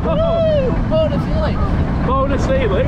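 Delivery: high excited yells mid-ride; on a roller coaster. Real, not acted. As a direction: shout and laugh through the ride. Riding the coaster, exclaiming.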